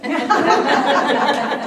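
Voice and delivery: big, high laugh out loud; loud burst of laughter. Real, not acted. Speaker laughing at the host's joke about her move, loud laugh. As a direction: laugh hard at the teasing.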